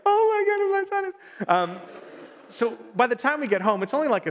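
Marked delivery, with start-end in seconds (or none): 0.1-1.5 much higher, choked-up mock blubbering; a hint of tearful overwhelm. Father retelling how he choked up watching his son pray. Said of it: "probably blubbering myself".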